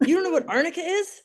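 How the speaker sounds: mock outraged kid asking how she can't know; incredulous daughter. Eight-year-old daughter scolding the nurse, incredulous high. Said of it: "my daughter goes".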